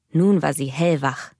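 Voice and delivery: usual speaking voice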